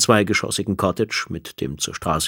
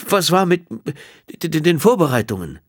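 stammering; 8 semitones higher, 4 dB louder than usual. A narrator's reading. Alfie stammering in shock.

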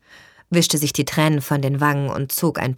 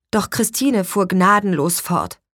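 plain reading voice

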